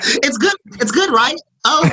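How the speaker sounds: high proud potluck auntie; sassy auntie voice. Pretend. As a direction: brag like the auntie who cooked. Voicing Tiffany at potluck.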